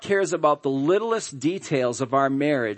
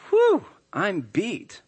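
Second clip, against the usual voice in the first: slow, dragged-out mock sigh then a louder insult; sarcastic jab; 2 semitones higher, 4 dB louder, much slower than usual. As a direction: drawl it out, then land the insult. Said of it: "That's what she was saying".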